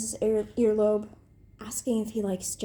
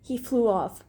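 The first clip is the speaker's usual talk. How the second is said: usual speaking voice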